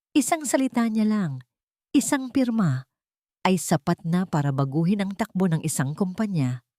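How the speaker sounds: plain reading voice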